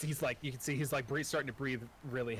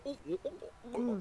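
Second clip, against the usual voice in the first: gulping hoots; 11 semitones higher than usual. Acted. Dwarf gulping ale.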